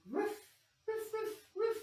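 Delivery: high, yappy little dog barks; puppy arfs. Pretend. Teacher barking like a dog in the yoga pose. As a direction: bark like a happy puppy. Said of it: "And dog".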